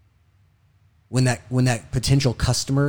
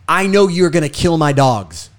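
louder, higher retelling of a rant; mildly raised voice imitating a man yelling; imitated yelling; 4 semitones higher, 6 dB louder than usual. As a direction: quote an angry rant at partial force. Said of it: "In all his yelling".

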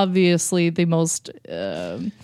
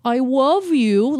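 put-on a touch higher, slow girly coo; mock twelve-year-old girl; 6 semitones higher, slower than usual. Imitating a twelve-year-old girl, high coo. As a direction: lightly voice a young girl. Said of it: "a twelve-year-old girl who goes".

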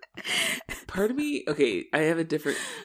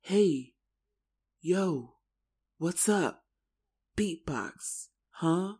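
a hint of a beatboxing cool guy; mock hip-hop kid; 4 semitones higher, much slower than usual. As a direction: toss out isolated slang with long gaps. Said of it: "it's very much like".

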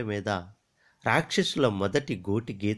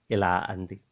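ordinary delivery, nothing marked

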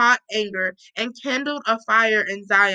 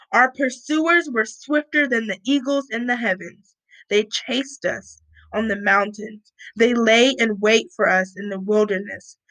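normal talking voice

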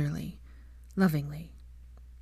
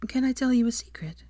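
higher, coy woman's voice; young woman voice; 6 semitones higher than usual. A narrator's reading. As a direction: tease him lightly. Female character voiced.

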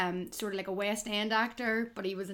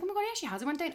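high surprised exclamation; surprised gasp; 9 semitones higher, much faster than usual. Retold or imitated. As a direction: say it up high, amazed. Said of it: "I was like".

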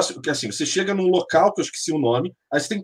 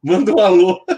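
ordinary talk

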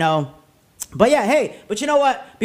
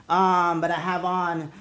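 normal talking voice

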